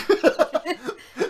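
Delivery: loud giggling fit; high squealing laugh. Real, not acted. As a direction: crack up laughing. Laughing at own rap.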